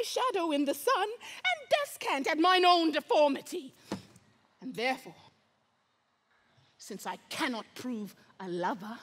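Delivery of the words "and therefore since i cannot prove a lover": very low and soft, with long pauses; hushed villainous resolve. Acted. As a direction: sink almost to a whisper, confiding a dark plan. Villain confides his dark resolve.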